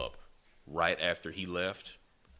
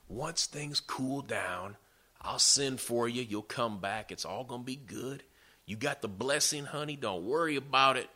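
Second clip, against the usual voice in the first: higher, often softened coaxing voice; motherly reassurance; 4 semitones higher than usual. Retold or imitated. Mother sending her son away for a while, reassuring. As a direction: imitate a soothing mother, pitch lifted.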